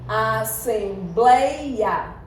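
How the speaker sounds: "assembleia" very slowly, each syllable stretched; drawn-out syllables. Shown as a demonstration. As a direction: stretch out "assembleia" as a-sem-bleia. Repeats word slowly to show syllables. ("assembleia" bem devagar, sílaba por sílaba)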